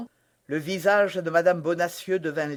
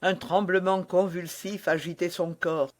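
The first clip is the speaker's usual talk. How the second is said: plain reading voice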